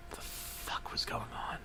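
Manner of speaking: hushed, lost aside; bewildered whisper. Acted. Narrator whispering confusion in the boss's office. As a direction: whisper it, barely audible.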